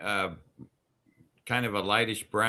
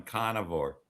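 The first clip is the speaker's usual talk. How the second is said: Maine accent; 6 semitones lower, 4 dB quieter than usual. Shown as a demonstration. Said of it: "I find myself going".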